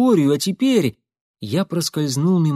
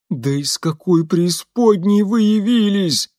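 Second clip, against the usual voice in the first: higher, louder frightened groan of a servant; 6 semitones higher, 4 dB louder than usual. A narrator's reading. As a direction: cry it out, cornered and scared. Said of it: "простонал Лиам".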